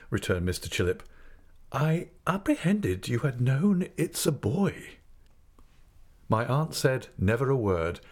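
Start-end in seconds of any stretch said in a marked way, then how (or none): 1.7-5.0 high, slow, hesitant voice; meek doctor, pitched up and halting; timid doctor. A narrator's reading. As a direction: break the news gingerly. Timid doctor breaks unwelcome news.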